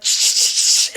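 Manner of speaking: breathy shh sounds; whispered shushing. Retold or imitated. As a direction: hush someone like in the film. Shushing in retold film scene.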